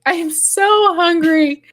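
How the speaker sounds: drawn-out mock-weepy complaint; mock crying whine. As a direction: retell yourself sobbing in the car, hammed-up. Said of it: "And I was like".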